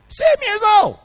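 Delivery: high, loud burst of amazement; amazed exclamation. Real, not acted. Exclaims at the son's young age.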